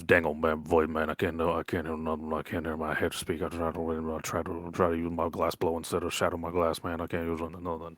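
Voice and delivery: low, rambling country mumble; mumbling lizard folk, complaining. Acted. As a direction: grumble along low and slurred, words running together.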